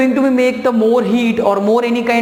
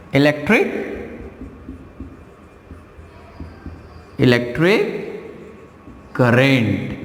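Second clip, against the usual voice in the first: slow dictation; 7 semitones lower, 3 dB quieter, much slower than usual. Shown as a demonstration. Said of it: "teacher dictates new topic slowly".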